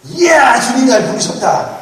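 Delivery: mock excited Jeremiah. Pastor playing an overjoyed Jeremiah answering God, hammed-up excitement. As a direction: gush like a thrilled kid called by God. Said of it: "예레미야의 대답은 뭘까?".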